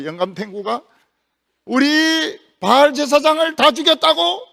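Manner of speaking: high, loud, spiteful queen yelling; vicious queen. Acted. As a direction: spit it out like a furious wicked queen. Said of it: "이세벨이 아주 표독스럽게". (악독한 왕비처럼 높고 크게 소리치듯)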